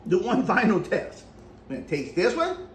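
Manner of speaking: tomato at lips; speaking while biting food. Real, not acted. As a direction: mouth partly blocked. Seen 1: Holds tomato slice throughout, bites it at 4.7 near end.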